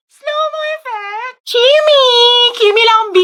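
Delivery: high, slow excited echo then squeals; kilig squeal. Acted. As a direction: shriek with giddy teasing. Friends squeal at crush story.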